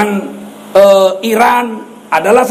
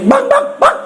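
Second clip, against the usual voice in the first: high, panicked scream of the wife, much higher; wife's scream. Retold or imitated. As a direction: scream for help like a frightened wife. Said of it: "istri saya menjerit".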